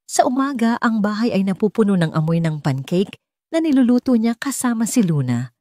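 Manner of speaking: ordinary delivery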